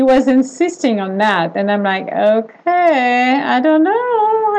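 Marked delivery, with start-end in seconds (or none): 2.1-4.6 high, slow, drawn-out mock politeness; smiling politely. Retelling a brush-off to a pushy stranger, smiling politely. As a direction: sing it out high through an uneasy smile. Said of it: "And with a smile".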